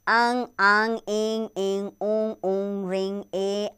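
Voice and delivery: अंग, आंग, इंग, ऊंग sounded through the nose and mouth together; nasalised vowel drill for children; nasal vowel demo. Shown as a demonstration. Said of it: "उच्चारण नाक और मुख दोनों से होता है".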